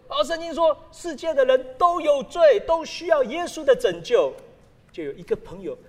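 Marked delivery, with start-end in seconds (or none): 0.1-4.3 pastor preaching. Retold or imitated. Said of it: "有个牧师他说".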